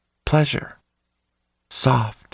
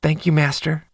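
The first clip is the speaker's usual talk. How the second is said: sweet voice; 3 semitones higher than usual. A narrator's reading. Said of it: "Cooper's sweet voice".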